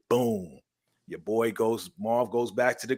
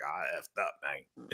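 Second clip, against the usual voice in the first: low, hushed imitation of regret; quiet dismay; 9 semitones lower, 13 dB quieter than usual. Man to the camera after his mistake, hushed dismay. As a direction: drop to a low mock mumble.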